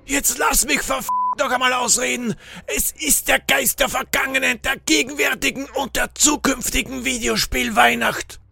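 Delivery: high, exasperated stranger's voice; impatient. A narrator's reading. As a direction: demand to finish, then proclaim it in a high strained voice. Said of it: "Der Unbekannte wurde ungeduldig".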